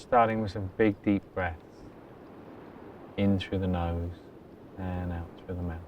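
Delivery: very soft, low-key meditation voice with long pauses; hushed, even delivery, letting each phrase settle; guided meditation. Acted. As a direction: guide them gently into stillness. Leads a meditation.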